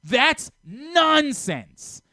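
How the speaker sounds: loud, high, slow scolding outburst; scoffing at a co-host's take, loud and drawn out; angry outburst. Real, not acted. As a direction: drag each word out in exasperated disbelief. Heated on-air argument over rankings.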